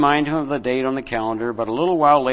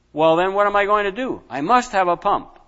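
upset protest; 6 semitones higher, 3 dB louder than usual. Acted. Boy protests to father.

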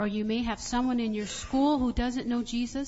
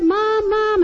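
high, loud acted yell; imitated alarmed child's shout; child crying out for mom; 11 semitones higher, 12 dB louder than usual. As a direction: call out urgently to mom. Frightened child calls mom again.

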